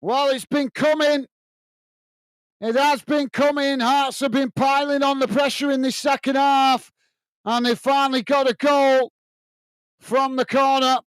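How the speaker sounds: lifted commentary after the equaliser; still excited. Real, not acted. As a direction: keep riding the goal excitement.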